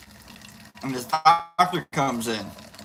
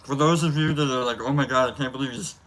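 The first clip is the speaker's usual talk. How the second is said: talking while chewing; mouth full; 3 semitones lower than usual. Real, not acted. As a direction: speak through a mouthful. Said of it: "talking with his mouth full".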